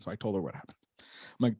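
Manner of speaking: normal talking voice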